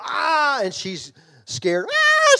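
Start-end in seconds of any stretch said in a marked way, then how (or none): 0.0-0.6 loud high "Ah!" leaping out to startle; scare yell. Retold or imitated. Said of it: "and I yell". 1.9-2.4 very high, very loud shriek of a woman caught off guard; retold startled scream. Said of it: "She screams".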